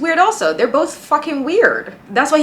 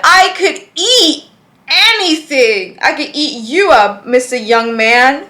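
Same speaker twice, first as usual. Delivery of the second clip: higher, louder, slow seductive cougar voice; mocking Cindy; 4 semitones higher, 5 dB louder, slower than usual. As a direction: purr it like a predatory older woman. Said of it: "Cindy said".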